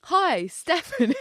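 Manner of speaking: loud, high, stretched-out sales greeting; mock an over-bright spam caller's scripted hello; fake scripted caller. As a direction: send up a phoney cold-call voice. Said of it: "putting on this fake scripted voice".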